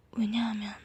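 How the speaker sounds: plain reading voice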